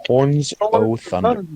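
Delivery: high, slow grand announcement; dramatic title voice. Shown as a demonstration. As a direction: announce it like an epic name.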